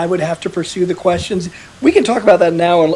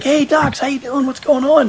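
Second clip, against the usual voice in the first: lightly voiced breezy greeting, pitched up; cheery patient; 7 semitones higher than usual. Mimicking a fellow patient greeting the doctors, breezy and higher. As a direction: toss it off like a chatty guy in the ward. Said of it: "he's like".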